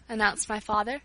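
ordinary delivery, nothing marked

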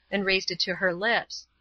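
ordinary delivery, nothing marked

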